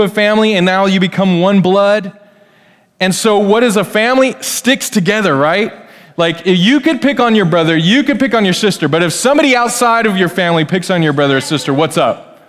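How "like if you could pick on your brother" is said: normal talking voice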